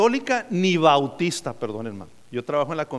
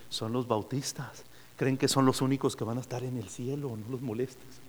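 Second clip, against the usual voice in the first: much softer and lower, a conspiratorial hush; hushed explanation; 6 semitones lower, 10 dB quieter than usual. Acted. San Pedro hushing visitors about the Baptists, soft and low. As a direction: confide the punchline so nobody overhears.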